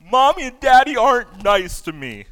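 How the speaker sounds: mock childish protest; complaining child. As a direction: imitate a small child feeling wronged. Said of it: "my kids thought".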